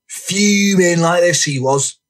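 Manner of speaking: theatrical, high and drawn out; Franny. Acted. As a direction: act out someone fuming, slowly. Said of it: "He theatrically bends his back".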